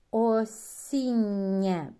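Осіннє slow and loud with a long soft нн; slow model word. Shown as a demonstration. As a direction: stretch the нн in Осіннє. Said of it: "Пом'якшення попереднього приголосного".

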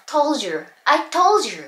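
"told you" blended into "tol-juu"; linked pronunciation. Shown as a demonstration. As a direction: demonstrate "I told you" with d and y fused into j. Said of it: "っていう感じですね". (「told you」をトウジュウとつなげて発音)

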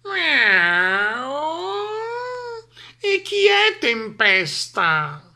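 high, slow, drawn-out cat voice; meowing cat. A narrator's reading. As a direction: purr the question lazily like a cat. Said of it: "miagolò la gatta". (Gatta che miagola assonnata)

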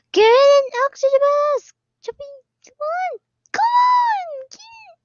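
very high, loud urgent commands; frantic shouts. Real, not acted. Player screaming at teammates to grab oxygen masks. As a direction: yell the team to the masks.

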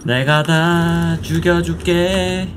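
아주 느리게 끄는 위협; 악당처럼 천천히 늘어뜨리기. Pretend. (느릿한 악당 위협)

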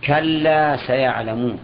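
slow, drawn-out citation of the verse; slow Quranic citation; slow verse recitation. Shown as a demonstration. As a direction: lay out each word slowly like reciting scripture.

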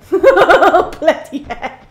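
loud, slow curse through laughter; laughing exclamation. Real, not acted. Recovering from a scare, laughing loudly. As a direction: laugh it out after the fright.

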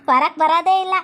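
ordinary delivery

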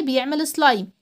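ordinary delivery

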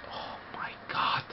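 breathy unvoiced whisper, slowed. Real, not acted. Gamer whispering to himself as the attack builds, hushed. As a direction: barely breathe the words out in suspense.

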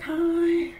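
high timid hi; nervous hello. Retold or imitated. Patient answering the nurse with a high nervous hi. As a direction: squeak a small scared hello. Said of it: "I'm like".

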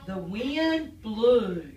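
slow, loud storybook read; reading to children. Acted. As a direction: read it slowly and big for little kids. Reading book title aloud.

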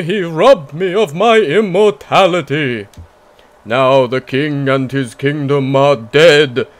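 slow theatrical vampire villain, building to a shout. Acted. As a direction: monologue like a gloating immortal vampire. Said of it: "That was my best vampire voice".

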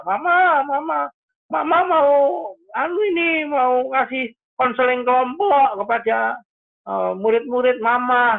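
put-on high-pitched kid's pestering voice; mock childish whine; imitated whiny child. Acts out child and parent exchange.

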